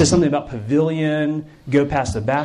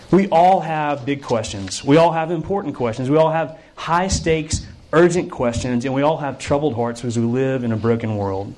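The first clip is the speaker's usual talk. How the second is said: normal talking voice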